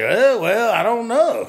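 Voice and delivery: unsure customer. Acted. Customer asked about keeping old furniture, acted as unsure. Acting out a hesitant customer's reply.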